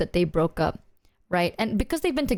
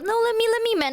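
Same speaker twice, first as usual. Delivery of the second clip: eager friend; 12 semitones higher, faster than usual. Retold or imitated. Friends fighting to buy drinks.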